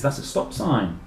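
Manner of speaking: usual speaking voice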